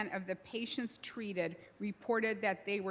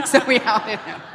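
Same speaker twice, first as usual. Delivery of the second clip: laughing it out, much louder; 3 semitones higher, 13 dB louder than usual. Real, not acted. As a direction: tell it through a real laugh. Laughs while telling the outing.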